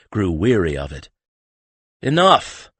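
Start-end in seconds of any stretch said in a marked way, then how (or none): 2.0-2.7 sharp, loud call to silence the room; high, loud order that cuts everyone off; commanding. A narrator's reading. Talamir silencing the bickering group, loud and commanding. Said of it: "he said, silencing them all".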